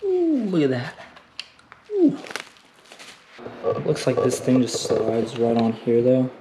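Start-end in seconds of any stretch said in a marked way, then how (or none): 0.0-2.2 high, delighted ooh; pleasantly surprised at the part; impressed ooh. Real, not acted.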